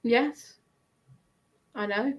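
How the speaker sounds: ordinary talk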